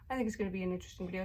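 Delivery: ordinary delivery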